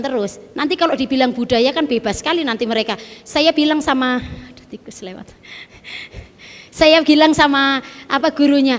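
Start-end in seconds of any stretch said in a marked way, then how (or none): 4.2-5.3 soft unvoiced aside; whispered aside. Real, not acted. Preacher noticing a mouse, whispered. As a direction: whisper it off-mic.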